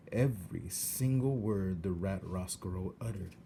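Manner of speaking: usual speaking voice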